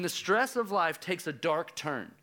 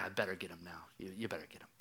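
low and much softer; restrained warning; 7 semitones lower, 11 dB quieter, faster than usual. Retold or imitated. Dad imitates himself nudging the lifeguard, restrained warning. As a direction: keep the warning down, almost to yourself.

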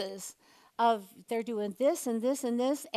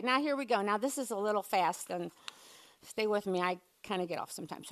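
normal talking voice

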